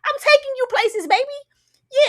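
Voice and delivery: high, loud put-on smooth-talker voice; lampooning a cheesy lover; mocking the boyfriend. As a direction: ham up his cheesy pickup line. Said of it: "you ain't never said".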